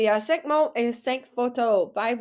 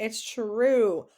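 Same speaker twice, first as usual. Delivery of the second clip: ordinary talk, nothing marked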